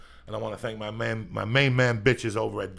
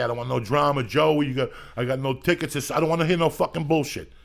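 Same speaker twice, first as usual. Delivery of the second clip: louder, higher hammed-up rant; mock angry rant; 4 semitones higher, 8 dB louder than usual. Comedian hypes his show to fans with a loud mock rant. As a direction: yell it at the fans like a tough guy. Promoting show, ranting at listeners.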